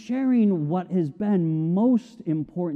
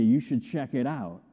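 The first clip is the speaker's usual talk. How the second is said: ordinary delivery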